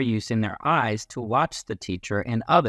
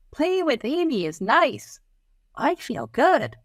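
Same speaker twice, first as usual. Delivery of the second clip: put-on much higher, happy child; high kid's voice; 13 semitones higher than usual. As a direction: speak as a cheerful classmate. Child voices about Amy.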